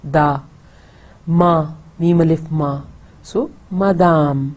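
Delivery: madame split da, ma, slowly; broken into syllables. Shown as a demonstration. Teacher breaks madame into syllables slowly. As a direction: spell out madame by its sounds. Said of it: "डी की जो आवाज़ है, वो दाल की है".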